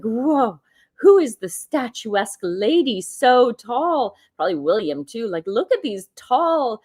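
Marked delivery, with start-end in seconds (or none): 0.0-4.1 high, drawn-out mock amazement; slow, swooping wonder, voicing someone staring; imitated awe. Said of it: "just the way she was looking at Catherine, like". 5.5-6.8 lightly voiced admiring onlooker; mock wonder, pitched up; imitated awe. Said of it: "the way she was looking at Catherine".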